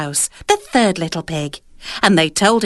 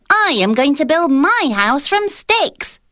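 little pig voice; 7 semitones higher than usual. A narrator's reading. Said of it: "said the second little pig".

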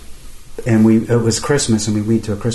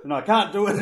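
laughing; 8 semitones higher, 3 dB quieter than usual. Real, not acted. Laughing at failed God imitation.

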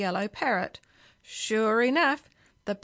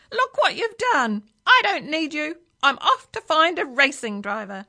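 screeching parrot; 5 semitones higher, 6 dB louder than usual. A narrator's reading. Parrot quits on the driver, screeching then easing. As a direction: scold him loudly as an indignant bird. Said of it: "it screeched at Billy Castor".

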